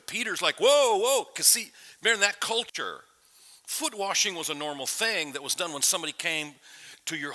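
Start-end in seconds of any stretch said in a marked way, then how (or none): 0.6-1.2 higher, louder startled objection; lightly acted startled protest; mock alarm. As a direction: imitate someone halting things in alarm. Peter startled by foot washing.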